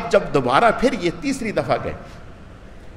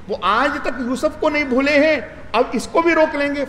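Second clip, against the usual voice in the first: pleading; 7 semitones higher than usual. Acted. Brothers pleading for their father.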